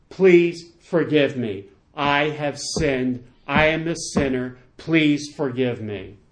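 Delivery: slow, emphatic confession; deliberate apology. Shown as a demonstration. Preacher models for listeners how to start reconciliation, slow deliberate apology. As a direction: space out every word with weight.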